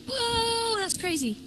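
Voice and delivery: long, high-pitched whoa; startled amazement. Real, not acted. Streamer seeing the water speed up, amazed. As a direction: let out a high, stretched note of amazement. Reacting to sudden speed change.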